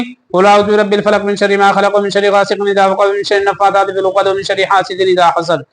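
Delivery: fast, run-together recitation with no pauses; rushed recitation. Shown as a demonstration. Teacher showing the wrong rushed way to students, fast and slurred. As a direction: gabble it quickly and swallow the words. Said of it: "ऐसे कुरान को पढ़ना गुनाह है".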